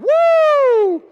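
long, very high, very loud "woo"; loud cheering woo. Retold or imitated. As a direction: whoop like an excited coffee customer. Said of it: "You guys cheer louder".

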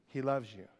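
very soft, low and falling; hushed, low words of comfort; tender reassurance. Real, not acted. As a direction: comfort them gently, almost under your breath. Preacher reassures the lonely.

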